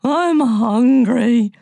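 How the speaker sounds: very slow, loud hungry moan. Acted. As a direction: groan it out starving. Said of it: "moaned Limon".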